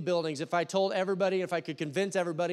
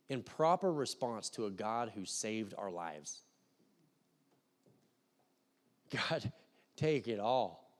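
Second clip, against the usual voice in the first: softer, slower earnest prayer; closing prayer; 6 semitones lower, 6 dB quieter than usual. Acted. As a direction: plead with God for the whole church.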